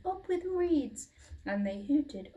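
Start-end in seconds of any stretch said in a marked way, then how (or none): 0.1-1.1 much higher, bright hoot; happy hooting. A narrator's reading. Duckbills hooting happily by the river. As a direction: hoot it with joy.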